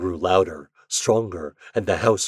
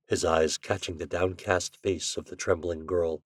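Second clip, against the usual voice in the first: ordinary delivery, nothing marked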